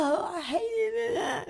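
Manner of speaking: high, slow, fake-crying whine; mock whiny cry. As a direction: whimper it like a sulking kid. Imitating a kid's whiny complaint.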